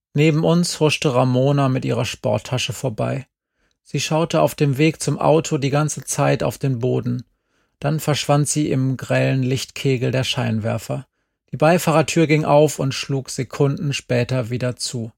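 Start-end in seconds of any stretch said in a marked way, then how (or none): none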